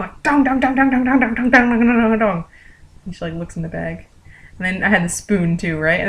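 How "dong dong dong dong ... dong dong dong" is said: rapid, raised "dong dong" pounding noise; imitated smacking. Coworker smacks bug with tongs, imitated rapid banging. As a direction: hammer out each repeated hit. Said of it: "smacking it with a tong".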